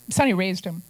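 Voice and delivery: usual speaking voice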